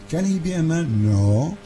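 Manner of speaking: normal talking voice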